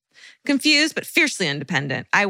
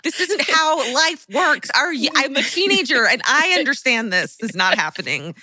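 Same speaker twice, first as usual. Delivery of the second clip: put-on exasperated teenager; 7 semitones higher than usual. Exasperated teen lecturing mom.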